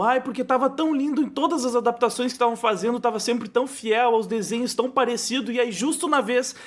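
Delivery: high-pitched whiny imitation of a complaining fan; upset friend. Retelling a feminist friend's complaint about the Little Mermaid, upset, high-pitched imitation. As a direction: mimic an upset friend's lament, pitched well up. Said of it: "ela ficou muito chateada".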